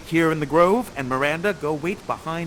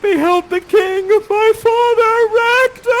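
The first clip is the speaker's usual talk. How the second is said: much higher, louder, slow and theatrical; hammed-up rapture; 13 semitones higher, 11 dB louder, slower than usual. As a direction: overact his joy and grief. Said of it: "You say".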